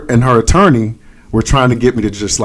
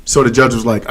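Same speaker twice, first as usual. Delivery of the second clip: ordinary delivery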